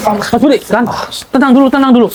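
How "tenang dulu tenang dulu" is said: urgent calling out. Acted. Calming his raging friend.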